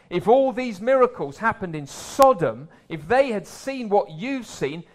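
rising preacher's fervour; forceful preaching. Acted. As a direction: press the warning home to the crowd. Preacher paraphrasing the warning.

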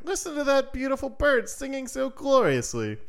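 high, dreamy father voice; enraptured father. Acted. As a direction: gush over the bird's song. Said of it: "said the man".

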